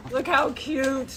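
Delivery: normal talking voice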